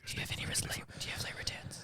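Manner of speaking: hushed library whisper. Pretend. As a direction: whisper your request to a librarian. Pretend library request.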